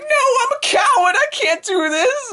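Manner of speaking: put-on very high whiny girly voice; Naka's voice. Naka refusing to fight, very high whine. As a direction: whine like a scared idol. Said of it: "And Naka's like".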